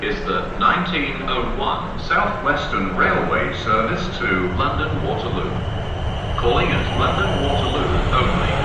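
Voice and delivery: ordinary talk, nothing marked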